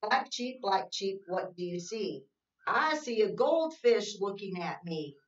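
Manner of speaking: sing-song read-aloud, slow and even; reading to children. Acted. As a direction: read the rhyme to little kids, slowly. Reads picture book.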